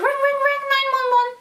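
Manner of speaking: high, sustained sing-song ring; high monotone telephone imitation; imitated phone ringing. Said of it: "They were calling".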